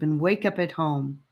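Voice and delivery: ordinary delivery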